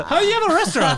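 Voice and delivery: high, loud laughing question; laughing disbelief. Real, not acted. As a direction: squeal it in disbelief.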